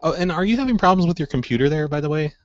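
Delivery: ordinary talk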